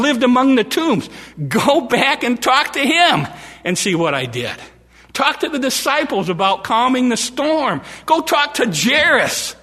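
climbing to an incredulous shout; preaching fervor. Acted. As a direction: preach it rising in disbelief.

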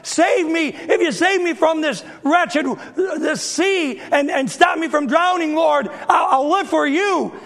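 high, pleading cry to the Lord; desperate plea. Retold or imitated. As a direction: beg for rescue from drowning. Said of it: "and he said".

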